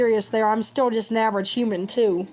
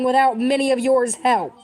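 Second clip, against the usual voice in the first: normal talking voice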